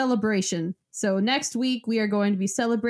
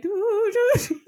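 put-on high warbly old lady singing; 11 semitones higher, 3 dB louder, faster than usual. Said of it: "do my best old lady voice".

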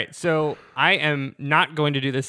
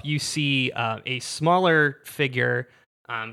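usual speaking voice

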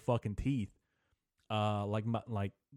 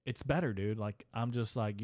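normal talking voice

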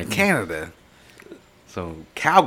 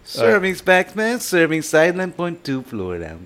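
bad Jamaican accent; 8 semitones higher, 4 dB louder than usual. Trying the label reading before giving up, bad Jamaican accent. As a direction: attempt a Jamaican accent and miss. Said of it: "I don't have a good Jamaican voice".

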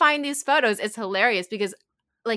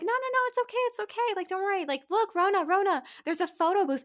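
high, coaxing voice of soothing friends; friends comforting; 9 semitones higher, faster than usual. Retold or imitated. Friends calming a sobbing girl, high and coaxing. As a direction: coax a crying friend toward the photo booth. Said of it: "all my friends were like".

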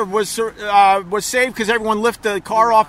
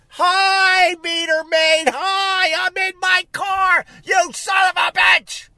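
high mocking yell; shouting; 8 semitones higher, slower than usual. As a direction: yell it out sarcastically. Yelling at meter maid.

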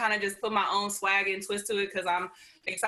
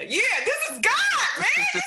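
high, loud, stretched-out excitement; excited exclamation; 5 dB louder than usual. Real, not acted. Exclaiming that this is God's doing.

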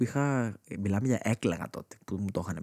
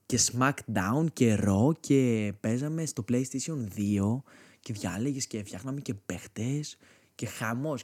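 ordinary talk

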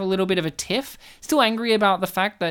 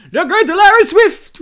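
high, booming mock boast; hammed-up, far louder character voice; put-on character voice; 11 semitones higher, 11 dB louder than usual. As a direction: imitate a showman crying out his own name. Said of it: "hearing him going".

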